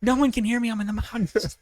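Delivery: high-pitched re-enacted yelling; screaming. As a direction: wail it out as if nobody can hear. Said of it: "just screaming at the top of my lungs".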